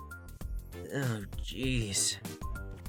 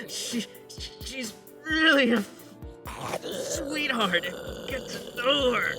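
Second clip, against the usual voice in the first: high, halting struggle to speak; pained, broken-up high voice; strained in pain; 10 semitones higher than usual. Acted. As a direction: insist all is fine while being mauled. Talking while creature attacks him.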